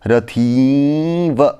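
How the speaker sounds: रथीव said very slowly, the vowel held and wavering; trembling drawn-out vowel. Shown as a demonstration. Teacher demonstrating the kampa tremor on रथीव, very slow. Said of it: "तो इस तरह से उसका उच्चारण होना चाहिए कंपन के समान".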